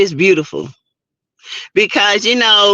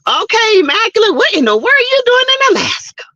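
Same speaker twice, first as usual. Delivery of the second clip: high, quick amazed question; can't believe she's up in Alaska; delighted disbelief, pitched way up; surprised; 12 semitones higher, much faster than usual. Real, not acted. Reacts to a viewer in Alaska.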